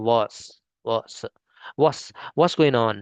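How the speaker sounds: "what's" said quickly as /wɔs/, t dropped; clipped what's drill. Shown as a demonstration. Teacher drilling the short form. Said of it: "इसको हम short में बोलते हैं".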